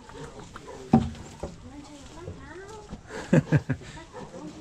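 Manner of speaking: a long high giggle that falls away. Real, not acted. Giggling at the calf nosing the camera lens. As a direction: giggle high and let it tumble down.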